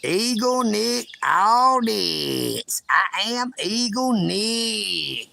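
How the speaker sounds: slow, drawn-out hint of an eagle; lightly voiced slow eagle intro; mock eagle voice. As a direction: drag the name out as a pretend eagle. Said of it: "How about this?".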